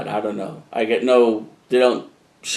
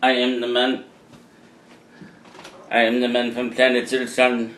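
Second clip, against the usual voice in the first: flat sci-fi alien speaking. Pretend. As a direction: play a hungry space alien. Alien voice opening the vlog.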